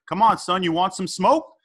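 lightly hammed-up court trash talk; trash-talking challenger. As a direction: goad them into a game.

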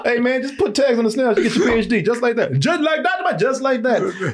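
mock Michael voice. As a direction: sell it easy.

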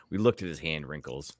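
police officer voice. Acted. Answering as the officer.

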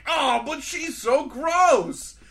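high, slow hammed-up whine; whiny disgust. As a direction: drag it out like a grossed-out teen. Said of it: "he's like".

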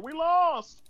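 mock holler. Friend mocks a Jets fan, hollering loud. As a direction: yell it like a sore sports fan. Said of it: "You were gonna start hollering".

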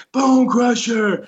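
slow, high, hammed-up ring name; mock wrestler callout. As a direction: drag out the ring name like a mocking announcer. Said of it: "he would be like".

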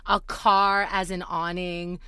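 ordinary delivery

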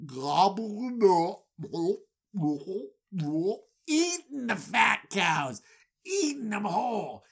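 a spooky storyteller voicing the cows devouring; mock monster gobbling. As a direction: ham up the creepy swallowing. Describing python cows gulping.

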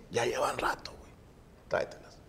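near-whispered retold instruction; discreet aside. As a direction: keep it hushed, between coworkers. Retells discreet word to a coworker. (en voz baja, casi susurrado)

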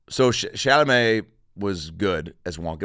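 usual speaking voice

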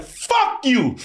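high, outraged retort at the screen; mock outrage. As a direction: fire it off high in mock disgust. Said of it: "I was like".

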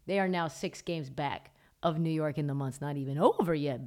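ordinary delivery

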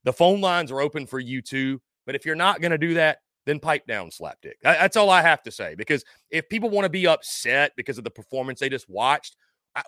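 ordinary delivery

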